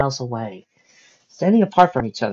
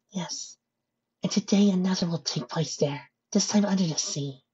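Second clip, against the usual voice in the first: higher, hushed character voice; soft-spoken, grave pony; 8 semitones higher, 3 dB quieter than usual. Acted. Pony foretelling another battle, high soft voice. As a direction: foretell it softly.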